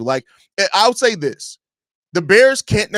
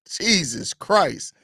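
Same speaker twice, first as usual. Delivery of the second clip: usual speaking voice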